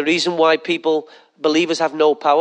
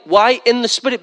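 usual speaking voice

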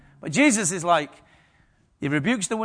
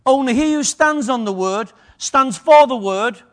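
loud ringing scripture proclamation, higher and louder; preaching at full force; 7 semitones higher, 9 dB louder than usual. Acted. Preacher proclaiming scripture to congregation, full force. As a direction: declare it to the whole church like a trumpet call.

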